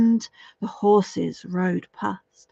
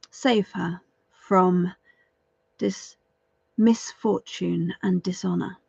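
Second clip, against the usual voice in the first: ordinary delivery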